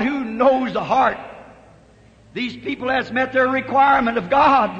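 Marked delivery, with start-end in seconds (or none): none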